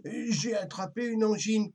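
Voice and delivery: a touch of a sore-throated rooster; sick little rooster. A narrator's reading. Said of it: "constata le petit coq".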